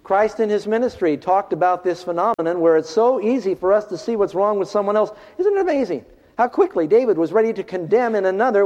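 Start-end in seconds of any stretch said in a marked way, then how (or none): none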